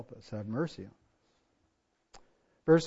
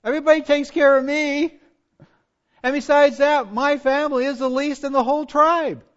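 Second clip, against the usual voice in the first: mock protest in a raised, higher voice; Gideon's excuses; 10 semitones higher, 10 dB louder than usual. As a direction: plead helplessness, playfully overdone. Acts out Gideon's self-focused excuses.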